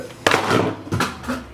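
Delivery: airless burst of real laughter; a short, soundless giggle; breathy silent laugh. Real, not acted. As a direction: laugh under your breath at the mess. Bronzer shattered all over desk.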